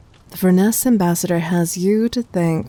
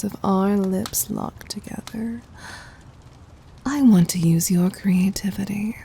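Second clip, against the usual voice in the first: whisper. Acted. Intimate teasing whisper.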